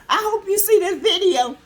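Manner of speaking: ordinary talk, nothing marked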